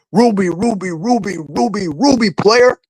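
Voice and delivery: King T L. Acted. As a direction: bounce through the repeats, lifting the last word. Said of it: "what about King T L?".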